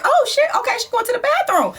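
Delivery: high, fast startled thoughts; sudden high alarmed muttering; startled inner voice. Retold or imitated. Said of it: "I'm like".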